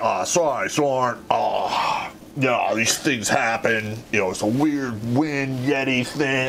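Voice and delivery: slow, offhand imitation of fake innocence; mock casual apology. Jumpers playing off their prank, slow casual excuses. Others' feigned apology, retold.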